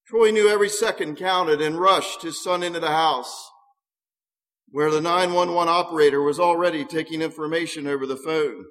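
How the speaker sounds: ordinary delivery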